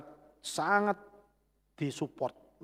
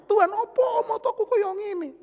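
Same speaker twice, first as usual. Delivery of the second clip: high-pitched wailing complaint to God; mock bawling like a small child; imitated crying; 5 dB louder than usual. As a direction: plead in a loud, high, whiny sob. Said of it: "Saya nangis di belakang".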